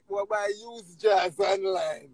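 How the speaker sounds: ordinary talk, nothing marked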